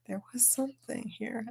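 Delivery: breathy whispered aside; whispering. Real, not acted. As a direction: whisper it to yourself, puzzled. Forgetful moment aside.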